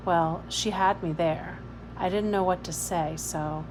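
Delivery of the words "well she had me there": ordinary delivery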